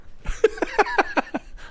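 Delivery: long, high-pitched laugh; real burst of high laughter; high laughing fit. Real, not acted. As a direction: crack up in a high giggle. Cracking up at his bit.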